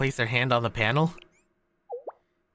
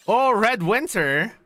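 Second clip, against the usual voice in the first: excited; 6 semitones higher, 4 dB louder, slower than usual. Acted. Excited exclamation.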